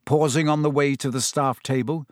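ordinary delivery

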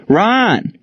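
high searching call that falls away. A narrator's reading. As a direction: call the name high, hoping for an answer. Calling for the missing teenager.

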